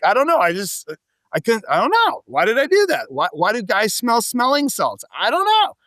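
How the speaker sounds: high, helpless comic excuse; mock bafflement. Acts out baffled excuse for smelling.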